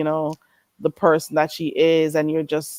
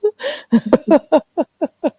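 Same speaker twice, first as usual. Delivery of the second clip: sudden high giggle; high burst of laughter; 11 semitones higher, 4 dB louder than usual. Real, not acted. Host laughing at her own stumbling, high giggle.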